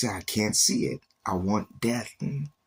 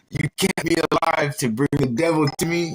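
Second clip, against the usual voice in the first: higher and louder, indignant; retold furious outburst; 7 semitones higher, 6 dB louder than usual. Complaining to God about the devil, loud indignant cry. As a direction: fling the accusation upward.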